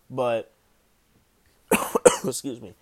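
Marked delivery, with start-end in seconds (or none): 1.7-2.2 sharp real cough; coughing fit. Real, not acted. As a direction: cough hard off the mic. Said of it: "I apologize about the coughing".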